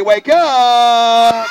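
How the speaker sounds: loud, long-held shout; shouted wake-up call. Acted. Leading the audience to wake the sleeper, shouting. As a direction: holler it and hold the last word.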